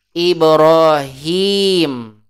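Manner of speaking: Ibrahim very slow, hi held long. Shown as a demonstration. Teacher giving Ibrahim for students to repeat, drawn long. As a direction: model Ibrahim slowly for repeating. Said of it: "Dengar, ikuti".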